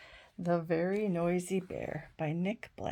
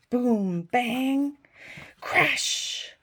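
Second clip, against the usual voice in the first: drum sounds; 5 semitones higher, 8 dB louder, much slower than usual. Acted.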